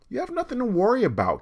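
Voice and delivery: high, quick, sugary reassurance; high chirpy teacher reassuring a child; sweet teacher voice. Acted. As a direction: soothe the girl like a kindly teacher.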